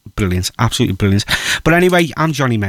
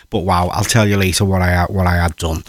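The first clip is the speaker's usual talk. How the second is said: ordinary talk, nothing marked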